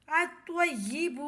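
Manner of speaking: الطیب said slowly as "at-tayyib", lam silent. Shown as a demonstration. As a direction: draw out الطیب with doubled toe. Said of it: "لام خاموش رہے گا".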